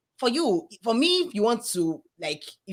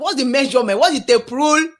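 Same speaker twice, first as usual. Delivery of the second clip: loud, higher-pitched mocking demand; mock indignant; 4 semitones higher, 8 dB louder than usual. Worker retelling a challenge to the accent critic, mock indignant. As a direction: pile up absurd questions in playful outrage.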